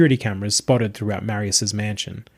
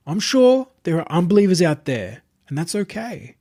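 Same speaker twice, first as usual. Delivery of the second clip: higher, calm, self-satisfied preacher voice; smug self-proclaimed messiah; 7 semitones higher than usual. Acted. Possessed streamer addressing his entourage, smug and serene. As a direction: announce your divinity like a smooth cult leader.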